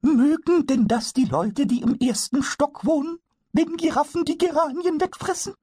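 a tiny goblin amazed at giraffes; wondering kobold. Acted. Pumuckl asks Eder about the neighbours, very high and wondering.

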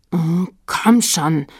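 annoyed. A narrator's reading. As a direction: draw it out in annoyance. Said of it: "sagte Fred ärgerlich". (ärgerlich gedehnt)

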